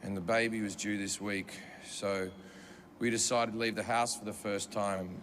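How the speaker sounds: somber apology. Retold or imitated. Husband apologizing to the comic for his crying wife, low and grave. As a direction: apologize heavily, low and sorry. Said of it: "and he goes".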